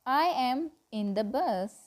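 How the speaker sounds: normal talking voice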